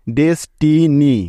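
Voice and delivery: Destiny said slowly, syllable by syllable; teacher saying destiny slowly; slow full word. Shown as a demonstration. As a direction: draw out destiny for learners. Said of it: "একসাথে উচ্চারণ হচ্ছে".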